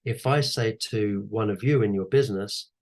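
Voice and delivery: ordinary delivery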